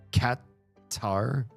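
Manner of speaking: normal talking voice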